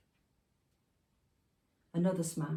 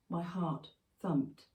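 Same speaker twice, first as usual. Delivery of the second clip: ordinary delivery, nothing marked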